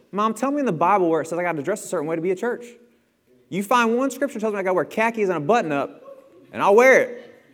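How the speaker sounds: put-on teen challenging mom over church clothes; defiant teen challenge. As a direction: argue like a smart-mouthed teen.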